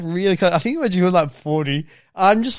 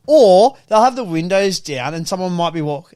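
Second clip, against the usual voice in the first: normal talking voice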